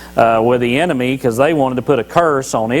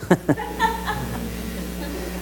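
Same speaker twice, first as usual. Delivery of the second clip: breathy laugh; 11 dB quieter than usual. Real, not acted. Laughing at own joke.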